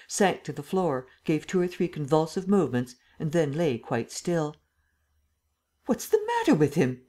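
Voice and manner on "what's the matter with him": high, startled question; alarmed exclamation. A narrator's reading. The Emperor sees Lupin fall to the floor, alarmed exclamation. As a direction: exclaim it with the pitch jumping up. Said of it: "exclaimed the Emperor".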